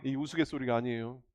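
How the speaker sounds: usual speaking voice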